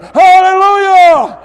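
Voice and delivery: loudest, high, drawn-out hallelujah; full shout. Real, not acted. Preacher giving in to the shout, slow high full-voiced cry. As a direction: hold it long and shout it to the rafters. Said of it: "I almost want to shout it".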